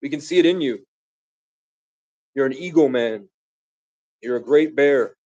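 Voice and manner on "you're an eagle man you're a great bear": ordinary delivery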